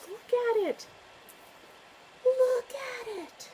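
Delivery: high and slow, gushing over the book. Real, not acted. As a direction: swoon over how pretty it is. Showing off a beautiful book.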